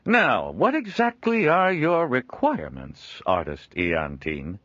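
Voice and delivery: a jovial trader chuckling at his own likeness; Joel, amused trader. Acted. Joel asking again.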